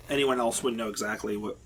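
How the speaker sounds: normal talking voice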